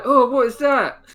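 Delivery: high, loud gasping wonder with a laugh; amazed onlooker. Pretend. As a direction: gawk at the strange sight. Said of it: "Some people are like".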